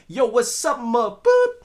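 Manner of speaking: loud, high hype greeting with a beeped curse; hammed-up shout, censoring yourself at the end; brash loudmouth guide. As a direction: holler it like the rowdy sidekick. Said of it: "me being the".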